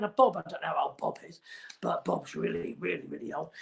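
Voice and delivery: whispered aside about Bob's age; hushed aside. Real, not acted. Whispering to the viewers about Bob's age, hushed. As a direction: drop to a secret whisper.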